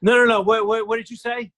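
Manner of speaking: loud, high imitated interrogation; stern demand. Stern producer demanding the actor repeat himself, imitated insistence. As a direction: stammer out a sharp demand, imitating a stern boss. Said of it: "stern guy".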